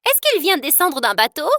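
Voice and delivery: a loud sarcastic retort; defiant sarcasm. Acted. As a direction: snaps back to mock the mocker. Girl sneers back at classmate.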